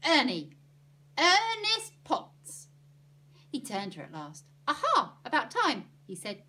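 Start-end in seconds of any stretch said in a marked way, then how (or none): 0.0-2.7 loud yell, second call higher and drawn out; exasperated shout for attention. A narrator's reading. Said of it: "yelled into his ear". 4.7-5.9 high, loud "at last" outburst; brisk, impatient cry; loud exclamation. A narrator's reading. Ernie finally notices her.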